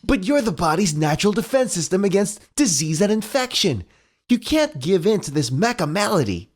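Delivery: rallying hero, read again; Sonic voice. Acted. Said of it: "quoted straight from the comic".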